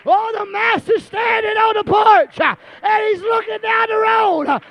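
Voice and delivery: high, loud, shouted whooping sermon; preaching at full force. Acted. Preacher voicing the father's joy to the church, shouted hard. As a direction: build the congregation up, shouting it out.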